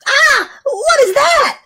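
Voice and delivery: high, slow scared yelp; child's startled scream; frightened cry. Acted. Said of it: "I yell for Grandpa".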